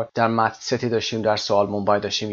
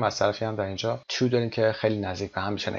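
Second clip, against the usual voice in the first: normal talking voice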